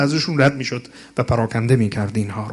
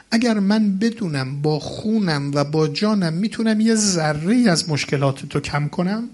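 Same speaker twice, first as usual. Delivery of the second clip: ordinary delivery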